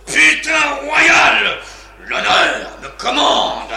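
slow, high, loud insult; furious yell. Acted. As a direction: spit the insult out. Insulte hurlée du bouffon. (insulte hurlée, lente et aiguë)

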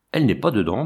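plain reading voice

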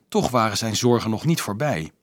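ordinary delivery

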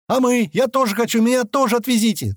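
high-pitched, urgent pleading; clamouring crowd. A narrator's reading. Men and women begging to go along, clamouring. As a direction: clamour to be taken too, pitched well up. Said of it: "Снова заголосили женщины и мужики".

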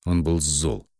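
ordinary delivery

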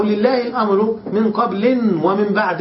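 قبل and بعد as qablin, ba'din; genitive with tanween. Shown as a demonstration. Teacher offering students the third reading, قبل and بعد ending in -in. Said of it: "قرأها بعضهم بالخفض والتنوين".

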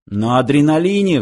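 plain reading voice

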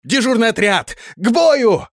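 high, loud alarm shout; frantic yell. A narrator's reading. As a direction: bellow the call to arms. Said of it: "продолжал истошно кричать часовой".

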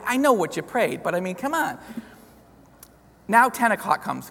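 ordinary delivery

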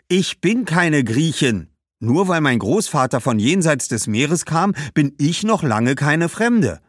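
langsam und laut, „bin“ betont; schimpfend. Acted. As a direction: beharrt verärgert. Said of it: "schimpfte Badbal". (Badbal schimpft, „bin“ stark betont)

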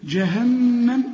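"جهنم" slow with the doubled nun hummed; slow tajweed "جهنم"; nasal ghunna. Shown as a demonstration. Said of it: "النون لازم تغن".

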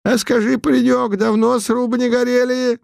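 put-on high mumbling old man voice; toothless mumble. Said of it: "шамкал Путилин".

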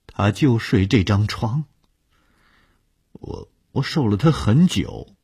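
a hint of a nervous stammering man. Stranger voiced, stammering.

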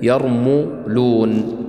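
يرملون as yar-MU-loon, very slow, with a clear u after the m; careful, drawn-out يرملون with damma on م; damma on the meem. Shown as a demonstration. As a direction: stretch يرملون out slowly, sounding the meem with an "oo". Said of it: "وننتبه أيضًا لضم الميم".

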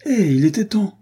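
high, loud triumphant cry; exclaiming. A narrator's reading. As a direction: burst in through the window with the cry. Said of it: "s'écria-t-il". (s'écrie fort et aigu, triomphant)

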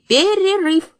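high, loud sudden call; loud announcement. A narrator's reading. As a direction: jump up and cry it out. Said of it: "вскочил и объявил".

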